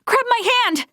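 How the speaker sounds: high, urgent plea to grab on; urgent call. Acted. Reaching for Leticia in the current, urgent call. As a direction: reach out and call her to safety.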